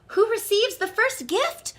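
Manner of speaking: high, loud, eager question; an eager chorus of children; children asking together. Acted. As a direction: ask it as a crowd of excited kids. Children ask all together.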